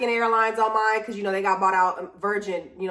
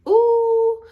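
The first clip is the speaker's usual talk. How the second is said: surprised ooh; 13 semitones higher, 6 dB louder than usual. Real, not acted. Sudden exclamation about storms.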